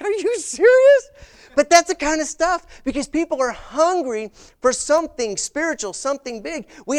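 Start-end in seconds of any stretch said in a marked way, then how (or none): 0.0-1.1 much higher, louder and slow, drawn out in shock; stunned disbelief. Retold or imitated. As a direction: ask it as if you can't believe your ears. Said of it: "I was like".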